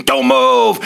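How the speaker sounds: loud barked order; a second cop shouting over the first; cop screaming. Retold or imitated. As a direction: snap it out as a command. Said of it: "the other cop is screaming".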